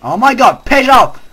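loud exasperated yell; angry yelling; angry shout. Real, not acted. Spider attacking again.

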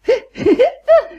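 short high squeaky giggle; gleeful little laugh. Acted. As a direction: giggle with glee at the dial. Delighted at dialling.

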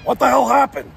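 loud, high Pacino-style outburst; Al Pacino impression. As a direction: belt it out like Pacino. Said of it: "If I were Pacino, I'd probably say".

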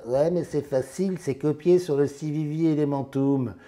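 lightly caricatured heckler raising an objection; mock objecting viewer. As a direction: imitate a viewer crying copycat. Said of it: "vous allez me dire".

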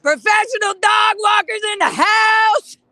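loud, high, slow hype call; hype shout. Real, not acted. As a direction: announce them like a party host. Hyping up dog walkers.

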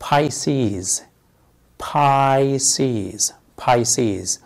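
Pisces said "PIE-seez", as in بايسيز; common pronunciation. Shown as a demonstration. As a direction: say بايسيز with a long "eye" in the first syllable. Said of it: "ولكن النطق الشائع هو". (نطق بايسيز الشائع بمقطع أول "باي")

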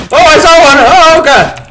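loud, high cry of alarm; startled yell. Real, not acted. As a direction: yelp in sudden panic at spotting an enemy. Startled by enemy appearing.

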